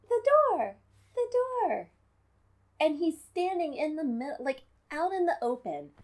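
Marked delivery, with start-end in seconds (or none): 0.1-1.9 high, mocking squeal of panic; mocking Gwildor yell. Mocking Gwildor shouting about the door, high panicked squeal. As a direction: squeak it like a panicked little creature. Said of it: "just yelling".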